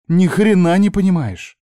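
high, loud, indignant retort; bitter anger. A narrator's reading. Niece rejects her uncle's understanding, angry. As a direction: fling his sympathy back at him.

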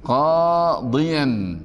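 قاضيا very slow, "qaa-diyan"; demonstrated tanween. Shown as a demonstration. Teacher showing the accusative without the article, demonstrated tanween. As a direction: stretch the aa of قاضيا and sound the closing "-yan". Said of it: "ستقول".

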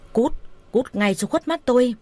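narrator's restrained angry send-off; angry dismissal. A narrator's reading. Man throws his lover out of the gate, mildly angry.

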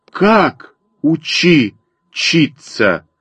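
drawn-out, astonished exclamation; crying out. A narrator's reading. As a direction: exclaim slowly, starting high, in disbelief. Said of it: "закричали мы с Костей в один голос". (протяжно, изумлённо вскрикивая)

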